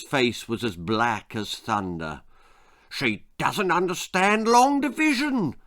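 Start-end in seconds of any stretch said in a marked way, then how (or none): 2.9-5.5 high, loud frustrated rant. A narrator's reading. Amos rants to Betty about the baby, high and loud. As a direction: rant in fury. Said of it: "he ranted".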